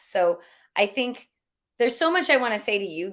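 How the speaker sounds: normal talking voice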